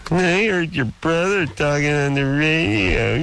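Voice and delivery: high, drawn-out mocking whine; mocking tattletale. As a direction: mimic a tattler, stretching the key words up high. Mocking people who tell tales.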